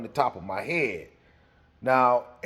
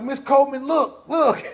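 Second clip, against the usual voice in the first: put-on pleading kid; 10 semitones higher, 5 dB louder than usual. Kid showing his head.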